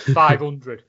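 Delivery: slow deadpan price. Retold or imitated. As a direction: drop it flat and slow. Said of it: "proper deadpan".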